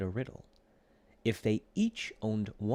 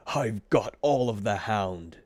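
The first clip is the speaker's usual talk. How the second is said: gloating; 7 dB louder than usual. A narrator's reading. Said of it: "he sang louder and louder".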